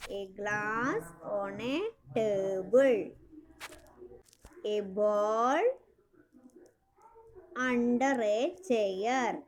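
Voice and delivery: very slow, stretched-out words for learners; slow teacher read-aloud. Shown as a demonstration. Teacher reading example phrases to children, slow read-aloud. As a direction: read slowly, phrase by phrase, drawing out each word.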